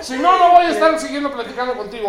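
high, loud, laughing mock rant; mock indignant refusal. Man offered a mint, mock offended and laughing. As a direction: ham up the offended friend.

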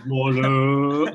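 Warlock belted out loud in a put-on voice; shouted catchphrase. The hero yells his catchphrase at the briefing, loud. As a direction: bellow Warlock like the film's hero. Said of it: "And then says, "Warlock" a lot".

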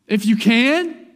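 loud, high, slow echo rising on "can"; incredulous question. Retold or imitated. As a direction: throw it back as a challenging question. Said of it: "Jesus is like".

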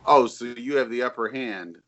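usual speaking voice

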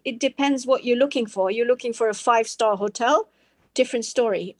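ordinary talk, nothing marked

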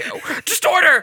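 high, loud impatient yell; exasperated shout. Retold or imitated. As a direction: snap at the customer to hurry. Worker losing patience.

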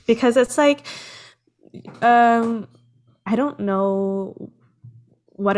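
fighting back tears, slow and wavering; teary. Real, not acted. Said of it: "Sorry, I'm getting teary now".

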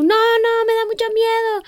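put-on high, whiny little girl afraid to swim; high scared child. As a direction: imitate a frightened small child. Imitating scared little sister.

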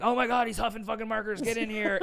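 high, urgent retold outcry; hammed-up alarmed call; mock alarm. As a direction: imitate someone calling for backup in a panic. Retold staff raising the alarm.

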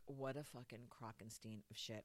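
low, soft inner grumble; dropped-down voice of private incredulity; deadpan disbelief. Retold or imitated. Retelling thoughts during the session, quietly disgusted.

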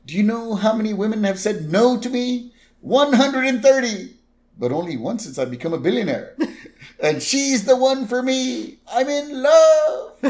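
put-on high giddy old man voice; mock lovestruck Burns; imitating a smitten old boss; Burns quote. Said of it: "Burns like".

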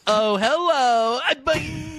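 high, loud drawn-out hello; goofy high greeting with a boing; boing-noise host. Acted. Host greeting listeners among sound effects, high and zany. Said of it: "a lot of boing noises".

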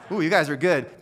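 ordinary talk, nothing marked

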